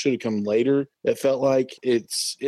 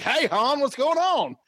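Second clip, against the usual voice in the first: much higher, casual mock Leia; Leia without British accent; 13 semitones higher than usual. Co-host imitating Leia greeting Han in Empire, mock casual American. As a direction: send up her dropped posh accent in a high voice. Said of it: "completely abandoned in Empire".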